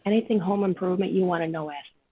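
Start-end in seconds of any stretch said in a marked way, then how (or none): none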